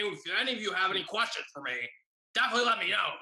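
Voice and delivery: loud, high imitation of a teacher; hammed-up glottal teacher, brisk; guttural teacher. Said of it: "now you know what she sounds like".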